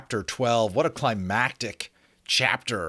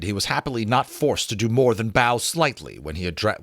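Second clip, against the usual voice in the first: ordinary delivery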